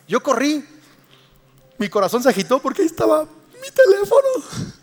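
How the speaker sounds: high and loud, breathless excitement; preacher reliving racing heart, excited high; excited retelling. Retold or imitated. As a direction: relive the panic, pitch up. Reliving the frantic run.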